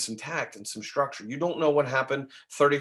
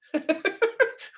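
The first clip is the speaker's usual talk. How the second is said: laugh; 3 dB louder than usual. Real, not acted. Amused at caller on another call.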